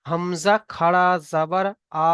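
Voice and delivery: slow spelling-out with a long held "আ" at the end; drawn-out vowel. Shown as a demonstration. Teacher showing students the one-alif stretch, drawn-out "আ". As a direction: stretch the final "আ" well out. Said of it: "শুধু এক আলিফ পরিমাণ টান হবে".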